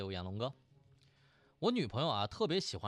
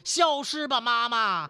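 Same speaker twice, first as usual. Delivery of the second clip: put-on high, loud child voice casting a spell; put-on high girlish command; little girl; 8 semitones higher, 7 dB louder, slower than usual. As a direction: cry it out like a little girl with a magic wand. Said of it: "对着她妈就说".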